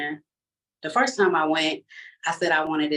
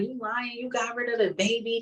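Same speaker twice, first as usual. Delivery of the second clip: lightly acted accusation, higher and softer; higher-pitched retort that calls out a lie; mother's accusation; 7 semitones higher, 4 dB quieter than usual. Mother catching daughter in a lie, mildly acted accusation.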